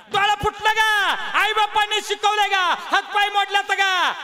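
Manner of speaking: imitating an old woman snapping at her daughter-in-law; scolding mother-in-law. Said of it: "म्हातारी बोंबलीच बघा सुनाला". (सुनेवर खेकसणारी सासू, थोडी नक्कल करत)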